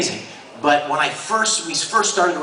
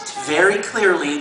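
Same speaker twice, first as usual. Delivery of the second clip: ordinary talk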